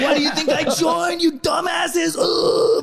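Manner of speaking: high, strained joke outburst; mock defiant shout. Recruit answering instructors back, high mock shout. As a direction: pitch way up, hammed-up yelling.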